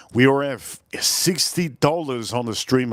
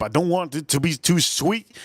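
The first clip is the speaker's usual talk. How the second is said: ordinary talk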